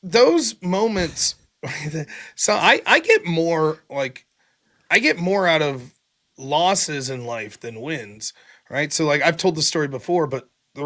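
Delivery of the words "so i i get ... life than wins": usual speaking voice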